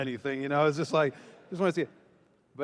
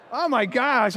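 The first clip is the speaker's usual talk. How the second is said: higher, louder gasp of alarm; shocked exclaim; 8 semitones higher, 5 dB louder than usual. Retold or imitated. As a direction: react as if the scene were a horror film. Reacting to an Exorcist-like scene.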